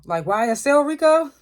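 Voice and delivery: mock confusion, as if he can't believe it; baffled. [name], confused by Tariq, asking in mock disbelief.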